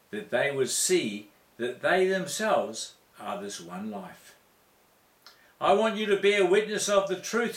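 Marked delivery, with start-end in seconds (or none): 5.6-7.6 loud quoted Jesus. Retold or imitated. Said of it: "said Jesus".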